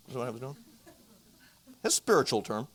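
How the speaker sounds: ordinary delivery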